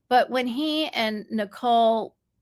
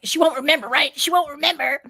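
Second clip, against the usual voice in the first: high, loud mimicry of his gloating; mocking a jerk; 6 semitones higher, 6 dB louder, faster than usual. Woman quoting ex at secret wedding, loud mocking imitation. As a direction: throw his words out in a mocking higher voice. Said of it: "he was such a jerk".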